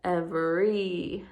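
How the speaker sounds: slow "Every" with a stretched long e at the end. Shown as a demonstration. Teacher showing the long e sound of y in every. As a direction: lean on the final "ee" of "Every". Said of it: "the Y is making the long E sound".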